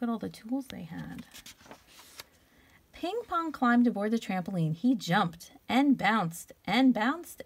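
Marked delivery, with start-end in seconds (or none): none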